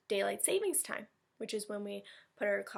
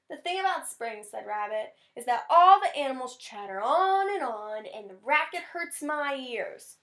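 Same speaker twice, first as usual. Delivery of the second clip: grumpy Rabbit; 3 semitones higher, 6 dB louder than usual. Acted. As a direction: complain about the racket. Rabbit complains about noise.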